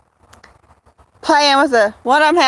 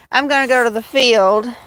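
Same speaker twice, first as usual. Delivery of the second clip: ordinary delivery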